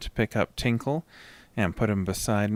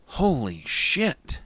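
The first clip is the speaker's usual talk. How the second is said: shaken relief; 7 semitones higher, slower than usual. A narrator's reading. Relief after the creature vanishes.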